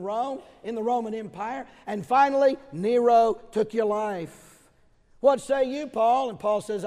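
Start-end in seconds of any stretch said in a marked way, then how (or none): 5.2-6.3 much louder and higher, calling out; ringing preacher's call. Real, not acted. Preacher calling on Paul before the congregation, loud. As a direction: summon Paul across the hall.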